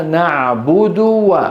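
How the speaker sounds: نعبد with the final u barely voiced, very slow; damma made sukun. Shown as a demonstration. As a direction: show the swallowed vowel. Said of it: "كأنها ساكنة". (نعبد بضمة مختلسة كأنها ساكنة)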